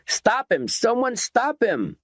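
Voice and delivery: high, urgent bellow, slowing on the second call. A narrator's reading. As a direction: yell for someone to stop the gunman. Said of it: "bellowed Garnish".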